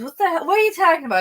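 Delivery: high, loud incredulous what the hell; a father baffled, raising his voice; baffled outburst. Retold or imitated. Dad baffled at daughter refusing to cook, loud and high.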